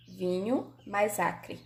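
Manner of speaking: "Vinho mais acre" said very slowly, word by word; "Vinho mais acre" spelled out slowly, one word at a time; slow separate words. Shown as a demonstration. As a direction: lay each word out slowly for the class. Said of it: "A gente não fala vinho acre".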